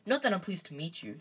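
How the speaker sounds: usual speaking voice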